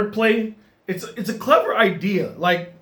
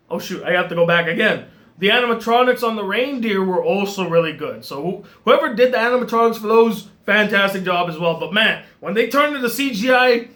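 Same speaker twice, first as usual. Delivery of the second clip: ordinary talk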